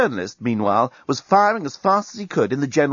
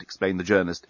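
ordinary delivery

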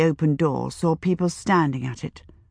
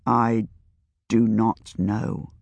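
deep, slow, low murmur; speaking very low; 7 semitones lower, much slower than usual. A narrator's reading. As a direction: sink the voice down low, slowly. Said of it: "speaking very low".

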